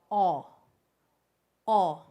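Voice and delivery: loud open "aw", mouth wide; open o sound. Shown as a demonstration. Teacher showing the O sound loudly. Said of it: "the letter O makes the sound".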